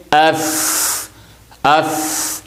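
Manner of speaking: اف with a lengthened, held ف sound; held doubled stop. Shown as a demonstration. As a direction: say اف holding the doubled ف before releasing it. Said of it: "مشدد ہو ... تو ہم پڑھیں گے". (اف میں ف کو تشدید کے ساتھ لمبا کر کے)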